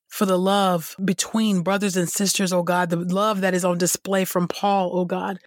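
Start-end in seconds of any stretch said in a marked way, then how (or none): none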